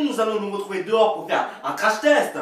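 normal talking voice

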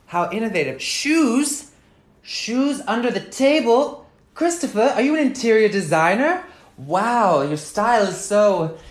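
loud, sarcastic teasing about the mess; sarcastic nagging. Acted. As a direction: needle him about the shoes. Sarcastic scolding about shoes.

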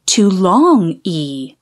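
long stretched ee sound, slow. Shown as a demonstration. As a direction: draw out the long E slowly. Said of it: "changes from short E".

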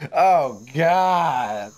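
sudden loud shock; startled yelp. Real, not acted. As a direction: yelp in fright. Startled by jump scare.

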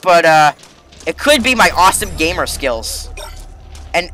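normal talking voice

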